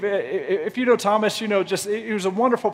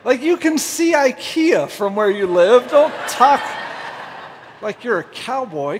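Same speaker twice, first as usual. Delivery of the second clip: raised-voice mock protest; exasperated retort; 4 semitones higher than usual. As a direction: call him out in playful outrage. Acted exasperated retort to Thomas.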